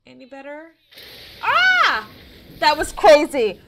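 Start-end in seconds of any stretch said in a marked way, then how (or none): 1.4-3.6 loud high yelp of shock; scream, then exclaim loudly; jolted by a sudden scare; startled scream. Real, not acted. Startled by sudden game event.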